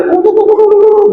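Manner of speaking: high, far-off whooping cheer sounds; imitated crowd cheer. Speaker imitating a game's cheering crowd for parents, high whoops. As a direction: mimic a game's victory crowd.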